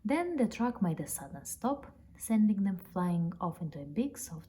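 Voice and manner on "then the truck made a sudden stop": usual speaking voice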